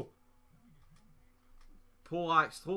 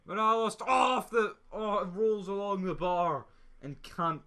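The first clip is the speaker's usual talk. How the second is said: high loud shout of disbelief; excited shout; 6 semitones higher, 4 dB louder than usual. Real, not acted. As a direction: shout as it hits the bar. Shot rolls along bar.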